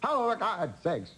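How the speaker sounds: high, wavering Katharine Hepburn voice; Hepburn impression. Burt imitating Hepburn scolding him on the phone. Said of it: "You think anybody else talks like this".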